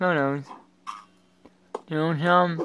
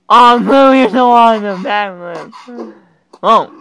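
loud, high praise; excited cheer; 4 semitones higher, 12 dB louder, slower than usual. Real, not acted. Player cheering the blue character on, loud excited praise. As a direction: shout your praise up high.

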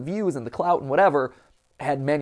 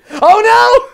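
high, loud laughing cry; laughing shout; 14 dB louder than usual. Acted. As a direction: yelp through laughter. Mock panic, laughing.

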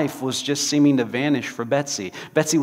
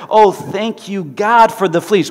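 loud, high, earnest thanks; mean it fully; fervent thanks; 6 semitones higher, 7 dB louder, slower than usual. Acted. Betsy thanking God for the fleas, loud.

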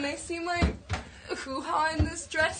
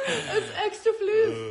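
teasing; 7 semitones higher, 5 dB louder, slower than usual. Real, not acted. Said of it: "Little floof".

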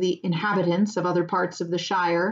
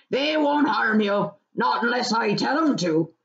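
slightly higher, unhurried rustic voice; country farmer, amused reassurance; 4 semitones higher than usual. Acted. Farmer reassuring Frodo about dogs, amused rustic voice. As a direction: reassure them as the dogs' master.

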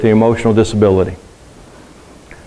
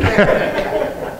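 soft breathy laugh; 7 semitones higher, 5 dB quieter than usual. Real, not acted. Congregation joke lands.

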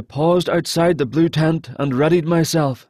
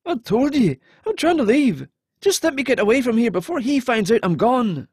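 much higher, urgent pleading voice; frightened captive; 8 semitones higher than usual. Acted. Captive desperate to flee the necromancer, high and pleading. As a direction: beg to be allowed to flee.

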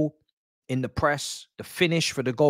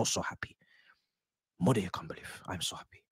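drops to a breathy whisper of joy; whispered, delighted to himself; fading to a whisper; 5 semitones lower, 11 dB quieter, faster than usual. Real, not acted. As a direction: let it fade to a hush.

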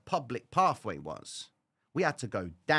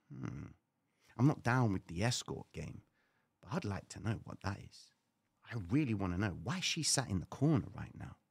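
quiet musing; 4 semitones lower, 8 dB quieter than usual. Retold or imitated. Curious private musing.

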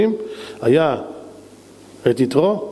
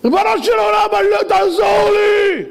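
long, high, desperate shout; stretched-out wailing yell to heaven; crying out to God; 4 dB louder than usual. Acted. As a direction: scream for help with all your might. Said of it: "תצעק אליי".

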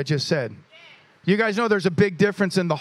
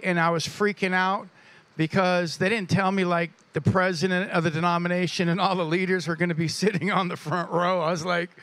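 ordinary talk

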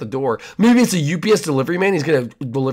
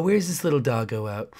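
creepy neighbor; 3 semitones lower, 6 dB quieter than usual. Acted.

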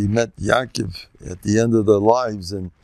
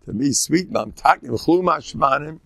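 normal talking voice

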